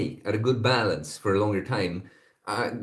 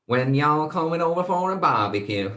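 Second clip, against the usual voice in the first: playful southern y'all voice, pitched up; southern accent attempt; 6 semitones higher than usual. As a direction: call it out in a put-on southern accent. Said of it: "That's a southern accent".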